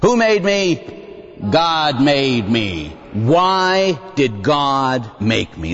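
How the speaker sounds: slow, measured catechism question and answer; slow rote recital. Shown as a demonstration. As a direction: recite it slowly like a child learning the catechism. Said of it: "It used to be in the Baltimore Catechism".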